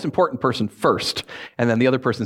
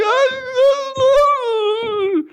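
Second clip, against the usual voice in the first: high, loud ah-ah-ah cry; imitating a child bursting into tears; crying second grader, hammed-up wail; mock childish wail; 4 dB louder, much slower than usual. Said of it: "I started crying".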